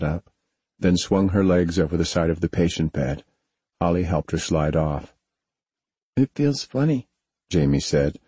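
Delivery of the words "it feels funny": put-on high, drowsy child voice; a tired young girl just waking; sleepy little girl. A narrator's reading. Jamie standing on numb legs.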